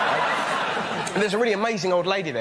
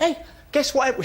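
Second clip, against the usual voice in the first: mum calling out; 8 semitones higher than usual. Retold or imitated. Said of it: "just looked up and went".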